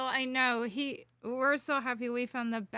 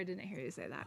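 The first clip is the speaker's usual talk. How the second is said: whispered; 7 semitones lower, 12 dB quieter, faster than usual. Real, not acted. Mock apology to partner.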